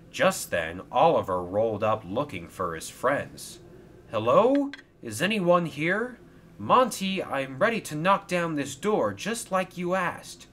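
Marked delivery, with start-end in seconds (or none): 4.1-10.5 calling out. A narrator's reading. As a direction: call out to find someone. Calling out in empty mine.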